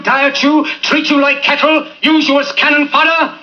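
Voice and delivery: building to a soaring shout; impassioned speech. Acted. Speech builds to rousing climax.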